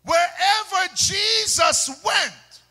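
high, loud pulpit shout; preaching at full force. Real, not acted. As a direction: proclaim it to the whole church at full volume.

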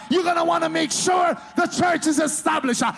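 loud, high shouted preaching; preaching at full force. Real, not acted. Preacher shouts about the devil to congregation. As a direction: drive it home at full volume.

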